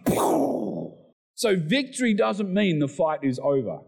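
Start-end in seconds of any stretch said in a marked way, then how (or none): 0.0-1.0 high, drawn-out explosion noise; vocal crash effect; imitated boom. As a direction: burst out a mock blast. Imitating a sudden blast.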